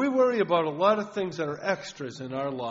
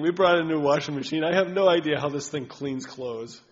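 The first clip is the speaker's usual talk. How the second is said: louder, exasperated complaining; loud complaint; 8 dB louder than usual. Real, not acted. Preacher griping to the congregation about the new washer, loud. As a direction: play up your gripe about the washer. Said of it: "I was gonna complain".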